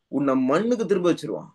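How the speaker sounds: ordinary delivery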